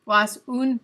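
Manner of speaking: slow, careful German pronunciation; attempted German accent. Shown as a demonstration. Streamer trying again for chat, in a careful German accent. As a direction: build up to a German rolled R. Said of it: "I have to roll my R".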